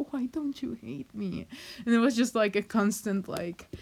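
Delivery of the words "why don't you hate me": soft unvoiced whisper of her own question; whispered quote. Retelling how she asked her friends, whispered. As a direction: breathe the question out under her breath, half-joking.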